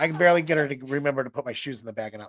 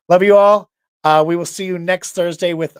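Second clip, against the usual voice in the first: loud sign-off; 5 semitones higher, 13 dB louder than usual. Real, not acted. Signing off to the audience.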